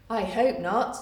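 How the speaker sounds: ordinary talk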